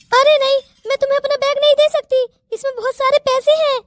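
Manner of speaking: high, scared refusal; frightened pleading. Acted. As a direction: clutch the bag and plead, scared. Girl refusing robber. (डरी हुई मिन्नत)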